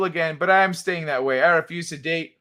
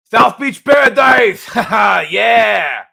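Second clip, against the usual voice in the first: loud, high, drawn-out lisping shout; Mike Tyson Lobo; 4 semitones higher, 9 dB louder, slower than usual. Acted. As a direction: belt it out as a cartoon bruiser with a lisp. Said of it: "Mike Tyson Lobo".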